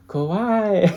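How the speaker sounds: high, loud squeal of Kawaii. Retold or imitated. Shop staff seeing a foreigner, loud high squeal. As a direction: gush it like a fan. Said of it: "all I can hear is".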